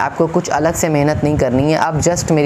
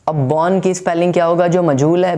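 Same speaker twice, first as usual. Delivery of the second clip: ordinary talk, nothing marked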